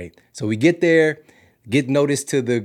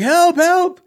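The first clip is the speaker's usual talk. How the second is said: very high, drawn-out loud yell; hammed-up stranded-survivor wail; mock screaming for help; 11 dB louder than usual. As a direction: holler desperately for rescue. Retold desperate yells at a car.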